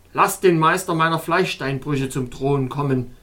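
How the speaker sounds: a lightly voiced cold king commanding; regal, measured order; icy dignity. A narrator's reading. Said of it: "mit eisiger Würde".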